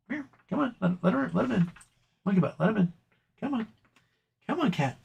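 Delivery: high, soft call to coax the cat in; coaxing pet voice. Real, not acted. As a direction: sweet-talk the cat through the door.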